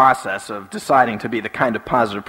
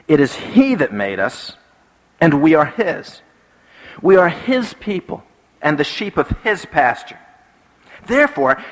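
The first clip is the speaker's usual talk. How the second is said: declaimed psalm, deliberate and emphatic; preacher reading aloud with rising fervour; scripture reading; 4 semitones higher than usual. Acted. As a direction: proclaim the verse, stretching key words.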